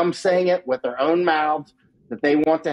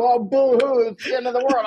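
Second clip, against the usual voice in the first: high, hammed-up whine of false despair; mock someone wallowing in self-pity; mock whining; 8 semitones higher than usual. Guest mocking doom-mongers with a high, hammed-up whine. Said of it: "sit down here and go".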